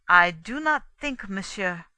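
mildly pointed, leaning on the first words. A narrator's reading. Poirot to Inglethorpe, mildly pointed. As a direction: make a pointed remark, starting firmly. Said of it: "said Poirot pointedly".

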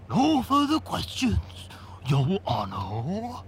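slow, raspy, worn-out impression; tired lawyer's rasp, dragging at the end; weary rasp. A narrator's reading. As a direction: rasp it out wearily. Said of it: "he would rasp wearily".

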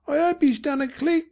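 put-on high-pitched young Cockney boy; boy's voice. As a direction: answer as a child, pitched high. Boy's line, tagged the boy said.

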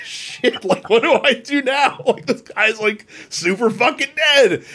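squeaky high whisper-shriek of panic; half-laughing fake horror; mock panic. Panicked at killing the druid.

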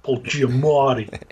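light New York accent, slow and drawn out. Shown as a demonstration. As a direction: say the name like a New Yorker. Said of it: "the only thing I can say in a remotely New York accent".

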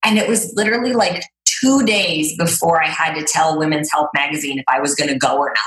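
ordinary talk

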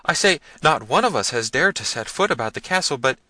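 high-pitched, flustered explanation; trembling servant. A narrator's reading.

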